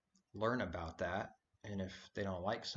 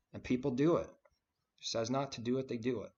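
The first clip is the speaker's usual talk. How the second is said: louder and higher, hammering the point; emphatic outburst; 4 semitones higher, 6 dB louder, faster than usual. Real, not acted. Preacher to the congregation, loud emphatic outburst. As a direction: rise up and call it out.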